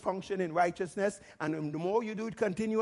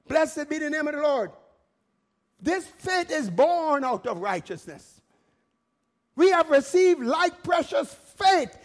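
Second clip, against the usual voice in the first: high, loud, fervent sermon declaration; full-force preaching; 8 semitones higher, 7 dB louder than usual. Real, not acted. Preacher declaring bold faith to the congregation, full-force preaching. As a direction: proclaim it boldly to the whole congregation.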